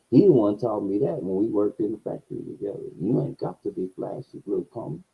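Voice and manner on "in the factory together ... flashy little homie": hushed fatherly advice. Retold or imitated. Older coworker advising young narrator.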